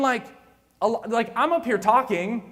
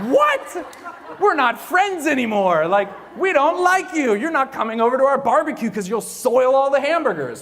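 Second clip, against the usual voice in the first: a high, loud yelp of What, then louder mock-angry scolding; mock outrage; 4 semitones higher, 5 dB louder than usual. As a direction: act out friends furiously shunning you, hammed up. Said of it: "People were like".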